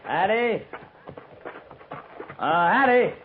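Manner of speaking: high call toward the cabin. Acted. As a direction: call out her name, searching.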